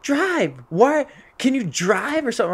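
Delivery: high, loud imitation of an impatient woman; mock irate driver.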